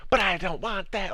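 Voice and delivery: high-pitched imitation of a contrary player; mock protest. Streamer mimicking players who change their minds, mock protest. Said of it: "it's like".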